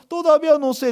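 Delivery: high, loud mock-tearful answer; imitated crying. Crying sister answers pastor about husband, mock wailing. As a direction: wail it like a frantic wife. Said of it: "Ella estaba llorando".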